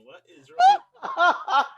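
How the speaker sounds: slow, high, loud exaggerated outrage; hammed-up disbelieving yell; mock outraged shout. Baz glaring at the friend, a hammed-up outraged yell. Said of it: "Baz be looking at you like".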